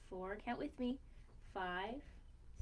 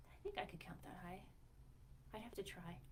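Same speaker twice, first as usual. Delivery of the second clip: soft, fast whisper; 3 semitones lower, 12 dB quieter than usual. Real, not acted. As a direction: whisper it like a little secret. Confiding aside to children.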